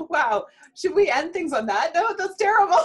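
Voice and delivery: high-pitched, laughing mock dismay; amused protest breaking into laughter. Real, not acted. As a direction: talk through the laughter, pitch climbing. Host cracking up, mock dismay at joke.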